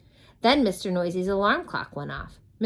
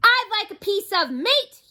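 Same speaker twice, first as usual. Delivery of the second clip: high, loud boom; 8 dB louder than usual. Acted. Mister Noisy booms at the butcher, booming. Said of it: "he boomed".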